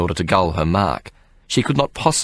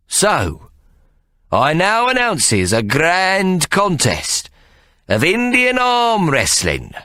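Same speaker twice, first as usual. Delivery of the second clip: much higher, slow showman proclamation; grand announcement; 12 semitones higher, much slower than usual. Acted. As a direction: proclaim it like a ringmaster. Ikey announces contest.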